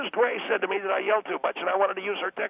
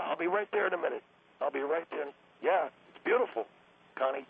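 ordinary talk

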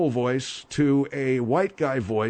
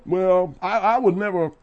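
higher, louder prissy protest; mock indignant; 7 semitones higher, 5 dB louder than usual. Host plays offended at tightening it, high prissy protest. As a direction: clutch pearls, I would never.

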